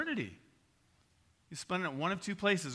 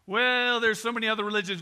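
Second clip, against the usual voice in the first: louder, higher, fast whiny excuse; mock excuse; 4 semitones higher, 6 dB louder than usual. Listener objecting to the preacher, a mock whiny excuse. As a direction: toss off the excuse quickly. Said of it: "imagined objector's excuse".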